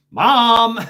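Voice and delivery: loud, high holler; retold child's cry for help; calling for mom. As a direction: yell for your mother upstairs, imitating your younger self. Child calls to mother for help.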